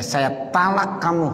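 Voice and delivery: "talak" with a plain ta at the start; ta-initial pronunciation. Shown as a demonstration. Teacher quoting a layman's divorce words, with a plain-t "talak". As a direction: say "talak" slowly, light t and open a. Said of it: "bisa nyebutnya ta".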